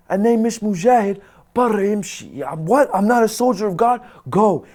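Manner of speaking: angry guard. Retold or imitated. The insulted guard snaps back at the prisoner. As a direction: bark back as the offended guard.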